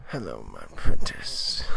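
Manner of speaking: very low, menacing Sith growl; Darth Maul voice. Retold or imitated. Retelling Maul's intercom greeting to the heroes, very low and menacing. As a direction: drop way down into the villain's voice. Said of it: "And he's just like".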